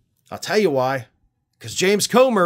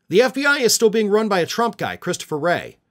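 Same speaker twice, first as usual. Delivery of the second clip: normal talking voice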